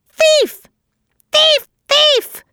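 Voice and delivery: very high screeching accusation; harsh scream. A narrator's reading. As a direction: screech it like an angry jay. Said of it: "he screamed in his harshest voice".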